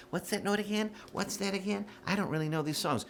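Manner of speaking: high, soft mock-innocent voice; =put-on high voice of a clueless singer; mock a singer playing the choral trick; unprepared choir singer. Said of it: "don't do this choral trick".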